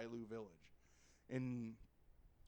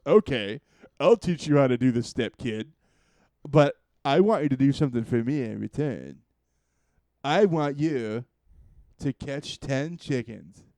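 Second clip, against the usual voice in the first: put-on old master voice; 14 dB louder than usual. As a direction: speak like a drunk kung fu teacher. Drunk master gives task.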